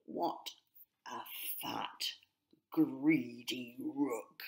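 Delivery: lightly voiced sneering rook, half whispered; mocking rook. A narrator's reading. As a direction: sneer at the greedy brother, hushed. Reggie mocks his brother.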